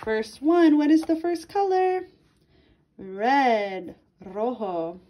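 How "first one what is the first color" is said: usual speaking voice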